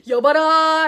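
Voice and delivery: lightly acted period-drama summons; a hint of sageuk command; historical drama call. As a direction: call out like a nobleman at the gate. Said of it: "우리 사극기 같은 곳 보면은".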